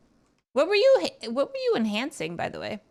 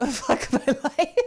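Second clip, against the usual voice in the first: laughing; 4 semitones higher, 4 dB louder, slower than usual. Real, not acted. Laughing at his own situation.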